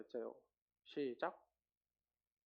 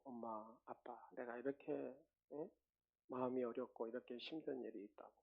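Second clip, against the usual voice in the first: put-on child confiding; 8 dB quieter than usual. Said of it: "부모님한테 와가지고 그러잖아요".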